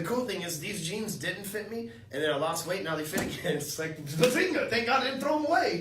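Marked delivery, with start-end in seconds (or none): 4.0-5.8 high, loud gleeful bazinga; hammed-up triumphant outburst; mock triumphant. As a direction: crow it like a silly victory cry. Imitates own triumphant self.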